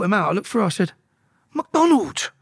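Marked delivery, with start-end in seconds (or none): 1.5-2.3 high exclamation of a name; surprised recognition. Retold or imitated. Prisoner looking through and recognising him, surprised exclamation. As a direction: blurt the name in disbelief.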